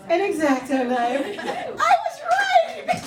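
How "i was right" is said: very high, drawn-out squeal of victory; triumphant gloating. Acted. Comedian crowing over winning against husband, triumphant. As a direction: crow it slowly, way up high.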